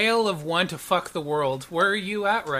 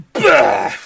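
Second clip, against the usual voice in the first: loud, higher gagging burst like vomiting; retching bah; 8 semitones higher, 13 dB louder than usual. Acted. GM voices Isaac vomiting blood with a loud retching bah. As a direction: spew the sound out.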